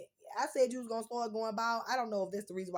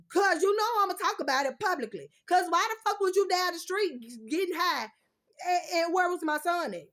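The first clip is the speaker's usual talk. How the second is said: loud, high angry rant at the ex; angry outburst; 7 semitones higher, 10 dB louder than usual. Real, not acted. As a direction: blast him with the accusation. Angry call-out to ex.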